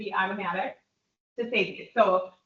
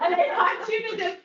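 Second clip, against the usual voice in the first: laughing; 9 semitones higher, 4 dB louder than usual. Real, not acted. Still laughing after her joke.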